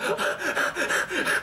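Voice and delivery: sharp high gasp, no voice; high unvoiced gasp. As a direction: gasp in pain, breathless. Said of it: "just sitting there like".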